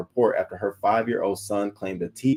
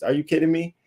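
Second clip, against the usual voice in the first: usual speaking voice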